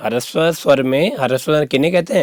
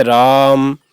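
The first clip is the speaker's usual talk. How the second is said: राम with the आ drawn out long; long calling vowel; much slower than usual. Shown as a demonstration. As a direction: call राम as if to someone far off, stretched. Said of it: "दूर से किसी को पुकारने अर्थ में".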